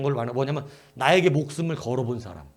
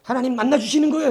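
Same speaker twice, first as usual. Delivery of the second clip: high, louder excited question; eager question to kids; 7 semitones higher, 5 dB louder than usual. Retold or imitated. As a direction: hype the children up. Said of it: "했더니".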